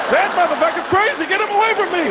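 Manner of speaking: a hint of a spooked local; mock scared onlooker. Comic voicing locals about him, mild alarm.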